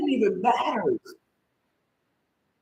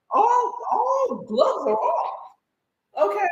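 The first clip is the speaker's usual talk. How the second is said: high, very slow tearful vow; 11 semitones higher, slower than usual. Real, not acted. Telling viewers she'll fight, crying and slow. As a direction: drag it out through tears.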